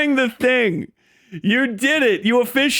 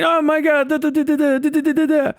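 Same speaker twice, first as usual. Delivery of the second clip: higher, whiny mock-complaining babble; mocking a whiny complainer, a bit higher; mock boo-hooing; 6 semitones higher than usual. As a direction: parody someone wailing in nonsense syllables. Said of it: "they'll boo-hoo in Hebrew".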